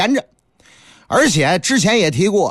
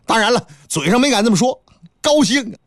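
ordinary delivery, nothing marked

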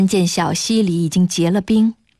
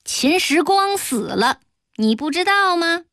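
稍高而缓慢地说出消息; 拖慢语速，抬高声调试探对方; 索性摊牌; 8 semitones higher than usual. A narrator's reading. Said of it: "索性说". (索性直说，语调拖长上扬)

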